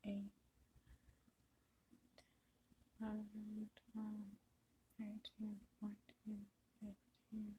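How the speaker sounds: whispered counting of stitches; counting under breath. Real, not acted. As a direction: count softly to yourself. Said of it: "let me count again here".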